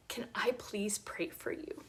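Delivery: whispered, gentle plea; soft whisper. Retold or imitated. Asking a grieving woman to pray for her, whispered. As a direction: ask quietly, almost breathless.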